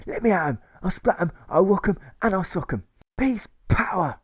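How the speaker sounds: high and loud, slowing on the last; boastful war cry. Retold or imitated. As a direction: chant it like a battle cry. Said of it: "the agitator boasts".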